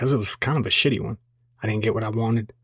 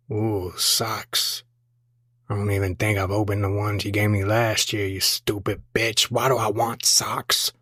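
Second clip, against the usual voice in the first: grumpy husband; 2 semitones lower than usual. Acted. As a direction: grumble ungratefully at the gift. Grumpy husband opening gifts.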